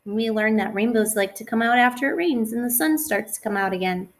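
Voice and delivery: sing-song rhyming read-aloud; reading to children. Acted. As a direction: bounce the rhyme for little kids. Reading a rhyming picture book.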